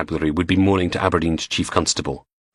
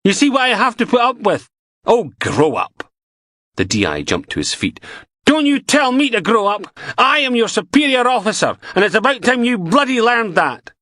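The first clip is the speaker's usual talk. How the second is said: angry yelling; 4 dB louder than usual. Acted. Beattie yelling at Logan.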